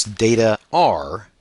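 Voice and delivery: "are" drawn out for emphasis; slow, deliberate "are"; stress on ARE. Shown as a demonstration. Lecturer teaching the plural verb, weight on "are". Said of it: "you want to note this".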